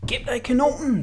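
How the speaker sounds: ordinary delivery, nothing marked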